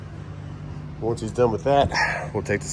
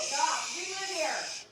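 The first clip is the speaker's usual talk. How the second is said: hushing; 12 dB quieter than usual. Real, not acted. Hushing the dog.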